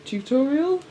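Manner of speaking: squeaky mock-surprised tutorial; high incredulous question. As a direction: pitch it way up, baffled. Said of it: "all about coach saying".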